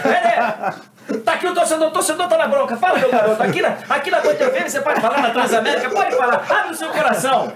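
high, loud, laughing radio reporter; mock on-air reporter. Reporter faking a live segment with a fan, laughing.

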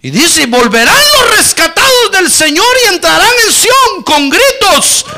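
high, loud, ringing scripture proclamation; fiery preaching. Acted. Pastor reads the promise to the congregation in full preaching voice. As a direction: lift the verse up over the crowd.

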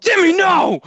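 high, loud cry of alarm; mock horrified shout. Shouting at little Jimmy in the story, mock horrified shout. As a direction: shout it like stopping a misbehaving kid.

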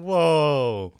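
loud, high, drawn-out whoa; mock shock. Real, not acted. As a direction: cry out in playful shock. Mock shock at friend's remark.

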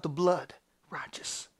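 low, soft, fading to a whisper; quiet, reverent assurance; hushed. Acted. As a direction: let the last word become breath. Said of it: "In the spirit that cries out".

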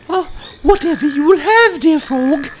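put-on very high, loud girlish princess; young princess voice. Princess surprised to find the frog speaking, very high girlish voice. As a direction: squeak it out like a startled little princess.

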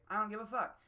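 a don't-care shrug in the voice; blunt retort. Retold or imitated. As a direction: toss it off carelessly. Imagined blunt reply.

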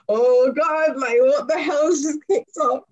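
loud, high inner panic, retold; high-pitched "what is happening" alarm. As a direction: exclaim in mock dismay. Retold panicked thought as fight starts.

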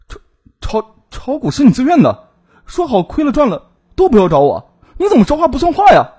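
stammering start, then high, loud protest; flustered. A narrator's reading. Man cornered over her lost money, flustered. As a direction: panic and trip over the first word, rising to indignation. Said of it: "夏瑞慌了手脚".

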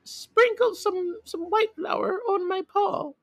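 very high squeaky wolf voice; high cartoon creature voice; wolf to miller. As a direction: squeal the request, drawing out the start. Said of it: "ran to the miller and said".

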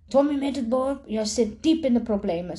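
a teacher telling off a pupil; juf scheldt streng. Acted. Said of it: "slingert juffrouw Machteld". (juf Machteld berispt Tommy streng, iets hoger)

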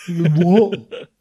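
a flat, dull "What?" dropping at the end like a dim lout; mock the thick kid with a dull grunted "What?". Teacher mocking a pupil, a dull grunted "What?". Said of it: "You don't sound like a thick inbred".

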